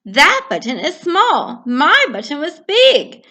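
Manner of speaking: complain, frustrated; cross Toad; upset Toad. Acted.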